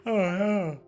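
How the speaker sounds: normal talking voice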